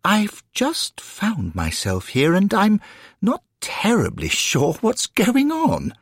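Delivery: high, slow, hesitant gentleman's voice; bewildered gentleman newcomer. Acted. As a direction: politely admit you're lost, pitched well up. Hesitant newcomer in story dialogue.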